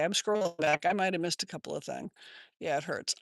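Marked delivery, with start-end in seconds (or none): none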